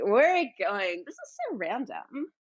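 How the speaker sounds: ditzy, baffled character voice; judgmental lip gloss. Acted. Lip gloss judging the emergency kit, baffled girly voice. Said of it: "my lip gloss is more like".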